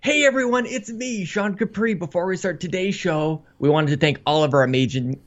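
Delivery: upbeat podcast host opening; show intro voice. Acted. Intro read retake.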